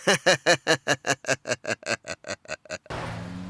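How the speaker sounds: low, slowly fading chuckle; long chuckling laugh. Acted. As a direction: laugh on until it dies away low. Laughs after goodbye.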